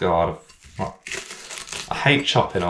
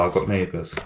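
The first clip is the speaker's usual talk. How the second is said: usual speaking voice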